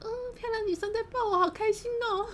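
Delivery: high-pitched gushing delight; gleeful inner voice. Retold or imitated. Speaker secretly delighted by the hug, gleeful. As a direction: squeal it like a secret happy thought. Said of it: "内心想着".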